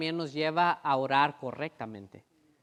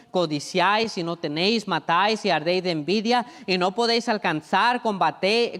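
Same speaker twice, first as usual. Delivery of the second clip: preached scripture reading; 4 dB louder than usual. Acted. Reads James 4 with mounting force.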